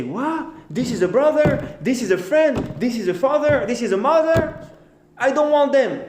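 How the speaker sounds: high-pitched, acted indignation; outraged disbelief. You hear your own family spoke against you, acted outrage. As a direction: exclaim in mock shock, pitch far up. Acted reaction to hearing betrayal.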